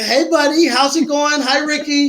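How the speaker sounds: cheery greeting. Retold or imitated. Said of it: "trying to gauge their tone".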